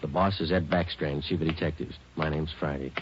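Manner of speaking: clipped, dry detective narration; hard-boiled narration. A narrator's reading. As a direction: lay out the facts flatly.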